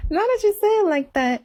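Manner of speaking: amused repeat to the husband; laughing echo. Retold or imitated. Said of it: "And I'm like".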